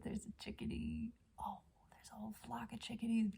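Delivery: soft, breath-light amazement; hushed wonder. Real, not acted. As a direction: whisper it so the birds stay. Spots birds on the balcony.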